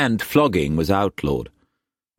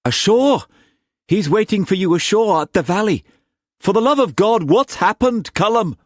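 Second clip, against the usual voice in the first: loud, high, agitated plea; urgent pleading; 4 dB louder than usual. Acted. Uncle begging his nephew for news, urgent. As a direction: beg for the news in mounting alarm.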